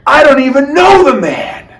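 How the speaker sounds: high, loud, panicked denial; Peter's frightened denial. Acted. Peter to the girl by the fire, high loud denial. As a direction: blurt it out to get the girl off your back.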